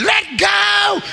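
high, stretched-out imitated yell; mock scream. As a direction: cry it out like a frantic altar worker. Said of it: "somebody else screaming".

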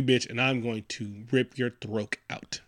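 low-volume, slow mock threat; menacing threat. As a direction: draw the threat out slowly and softly. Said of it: "He was upset".

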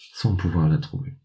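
plain reading voice

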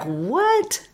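a high, soft, astonished "What?"; a hushed, stunned "What?"; emphatic what. Shown as a demonstration. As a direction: lift the "What?" high in quiet amazement. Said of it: "accent on it".

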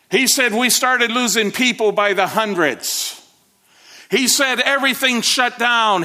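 preaching at full force. Acted. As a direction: build to a triumphant climax. Climax of the sermon story.

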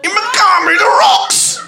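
put-on high, exuberant Geordie accent through laughter; hammed-up Geordie, pitched right up. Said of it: "I'm a Geordie".

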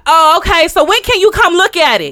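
loud, high mock realtor; imitating a pushy, booming caller; too loud caller. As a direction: push for an appointment loudly. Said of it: "bring it on down".

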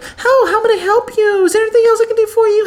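high-pitched, sing-song customer-service voice; exaggerated squeaky, eager waiter act.

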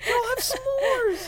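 very high sing-song voice; put-on voice. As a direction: coo like a sweet hostess. Put-on voice continues.

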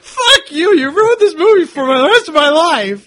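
high, loud tearful outburst; mock outraged cry. Friend yelling through tears that the movie was ruined. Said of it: "And he goes".

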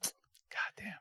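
breathless whispered awe; awed whisper. Real, not acted. Goosebumps recalling the movie, whispered in awe. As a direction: breathe it out, awestruck.

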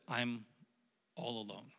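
very soft and low, trailing away; hushed, halting, forlorn; lonely lament. Acted. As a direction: act out feeling abandoned, almost to yourself. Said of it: "Maybe you've said it like this".

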